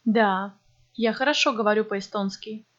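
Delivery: ordinary talk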